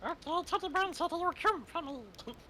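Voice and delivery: put-on high, sweet anime girl voice; squeaky cartoon anime girl; high cute anime girl. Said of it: "Do it in an anime voice".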